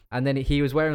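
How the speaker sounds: usual speaking voice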